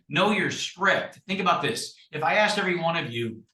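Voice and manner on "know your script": "Know your script" said slowly, each word weighted; slow emphasis. Shown as a demonstration. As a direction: spell out "Know your script" as a firm rule. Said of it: "So when I say".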